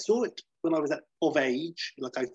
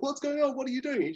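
very high acted-out questions; mock questioning; 13 semitones higher than usual. What Rusty would not ask the family, high mock questioning. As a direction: imitate a fussing questioner, pitched way up.

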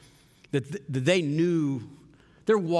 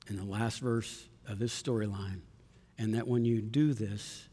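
quiet sermon close; 5 semitones lower, 6 dB quieter than usual. Acted.

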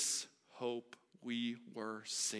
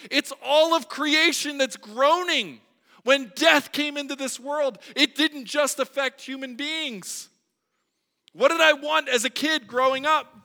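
higher, louder pulpit voice; preaching at full force; 11 semitones higher, 13 dB louder than usual. Acted. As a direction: lift the whole room with it.

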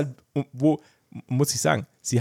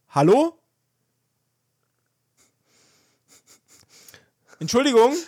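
loud, higher calls into the back room; calling out; 8 semitones higher, 6 dB louder than usual. Retold or imitated. Customer calling for the vanished clerk, loud call. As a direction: call out to someone out of sight. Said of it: "habe ich kurz gerufen".

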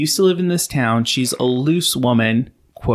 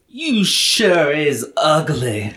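slow, drawn-out movie quote; quoting a movie line; 3 semitones higher, slower than usual. Retold or imitated. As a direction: drawl it like the character. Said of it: "she looks at Celia and says".

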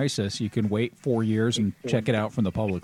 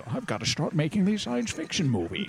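Sean Connery impression; 6 semitones higher, 6 dB quieter than usual. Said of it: "He says".

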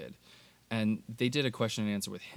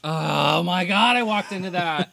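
loud, high slow groan; exasperated; 8 semitones higher, 11 dB louder, slower than usual. Real, not acted. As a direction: moan it after a bad joke. Groaning after falling for a pun.

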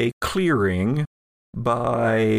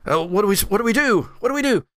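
high, rising panic; rabbit-headed thug panicking at the sirens; panicking henchman; 9 semitones higher, much faster than usual. Acted. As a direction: voice climbing higher with each repeat. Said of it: "the other one panics a little bit".

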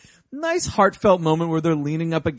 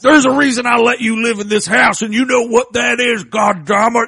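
louder, higher cranky scolding; cranky hermit; 6 semitones higher, 7 dB louder than usual. Retold or imitated. Hermit scolding his rabbit companion, loud and cranky. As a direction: scold the rabbit like a grumpy husband.

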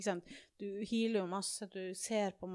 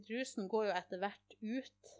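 usual speaking voice

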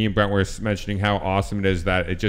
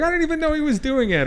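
mock protesting innocence; faster than usual. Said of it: "or he's like".

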